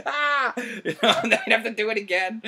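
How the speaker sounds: laughing through the words, high and loud. Real, not acted. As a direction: giggle while recalling the spray in the face. Getting sprayed, laughing it off.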